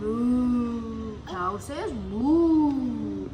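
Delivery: long, drawn-out moo; cow moo. Shown as a demonstration. Teacher showing what cow says, drawn-out mooing. Animal sounds for children.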